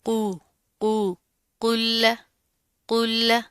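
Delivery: chanted "कुल्ल", heavy q into a light double l; heavy qaf. Shown as a demonstration. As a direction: make the q of "कुल्ल" thick and keep the l thin. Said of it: "कॉफ मोटा होगा".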